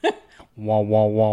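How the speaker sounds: low muffled trombone-like wah wah; mock Charlie Brown teacher voice; muffled wah wah talk. Teasing mock gibberish.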